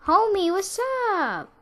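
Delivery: very high, drawn-out put-on voice; high mock greeting. Pupil answering the death smile, high mock greeting. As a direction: squeak it out, stretching the words.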